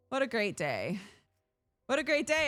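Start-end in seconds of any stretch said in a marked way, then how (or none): 1.9-2.5 higher, louder sarcastic cheer; mock cheer. Shown as a demonstration. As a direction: overplay the joy ironically. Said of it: "repeated mock cheer".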